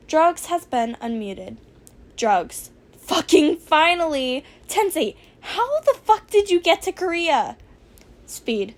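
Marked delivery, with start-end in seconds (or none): 3.0-7.6 exasperated yell. Acted. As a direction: shout it in disbelief. Exasperated chat outburst.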